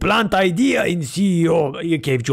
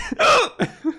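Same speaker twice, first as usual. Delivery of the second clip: hiccup. Real, not acted. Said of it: "this is involuntary".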